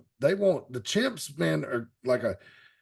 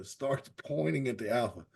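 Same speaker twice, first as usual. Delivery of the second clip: ordinary delivery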